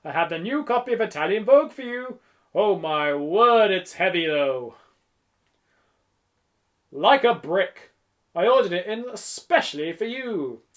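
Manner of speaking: theatrical, hearty shop patter; effusive shopkeeper. A narrator's reading. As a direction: make a big fuss for a favourite customer. Voices the shopkeeper.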